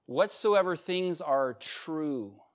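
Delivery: slow, measured scripture line; slow verse quote. Acted. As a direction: lay each word down slowly.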